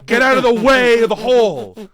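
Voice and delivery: loud high yell at the screen; mock shout. Yelling advice at the movie character, loud and high.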